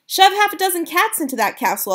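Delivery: high, loud eager cry; boy cries out. Acted. Boy urges grandmother to use cats, eager loud cry. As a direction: push the idea with excitement. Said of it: "I cried".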